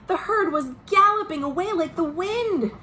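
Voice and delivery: lively read-aloud storytelling voice; storybook reading for children; story narration. A narrator's reading. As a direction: read it like a bedtime picture book to kids.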